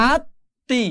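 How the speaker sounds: "আত্মি" as at-ti, slow with a held t; doubled consonant. Shown as a demonstration. Teacher demonstrates the second reading, "আত্মি" with a doubled t. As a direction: stretch the stop in "আত্মি" before releasing ti. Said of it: "এরপরে উচ্চারণ হবে".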